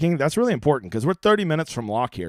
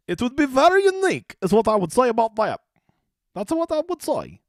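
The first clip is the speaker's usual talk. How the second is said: squeaky put-on voice, much higher; high silly voice; 9 semitones higher than usual. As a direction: pipe it up like a cartoon. Pitched-up funny voice.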